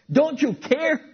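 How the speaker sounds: high, loud, slow desperate plea; panicked cry. Acted. Disciples waking Jesus in the storm, panicked high cry. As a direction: cry out as the boat sinks.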